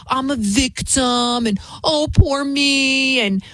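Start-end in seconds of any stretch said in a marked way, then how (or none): 0.1-1.5 slow, whiny mock victim voice. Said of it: "it's always about". 1.8-3.2 slow, higher, whiny mocking "poor me"; mock self-pity. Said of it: "it's always about".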